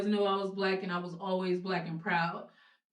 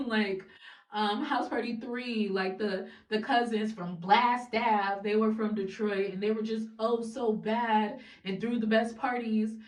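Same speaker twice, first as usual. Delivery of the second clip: ordinary talk, nothing marked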